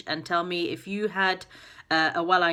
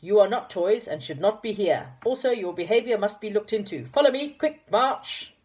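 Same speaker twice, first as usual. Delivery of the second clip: higher, louder toy captain barking orders; commanding captain; 4 semitones higher, 4 dB louder than usual. Acted. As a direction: drill the children like a sergeant.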